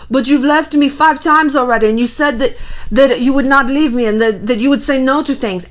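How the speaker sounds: put-on sulky boyfriend in vague European accent; general European accent. Sulking boyfriend to Paris backstage, mock European accent. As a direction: complain in a made-up European accent. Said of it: "I don't even know what this accent is, it's just, like, general Europe".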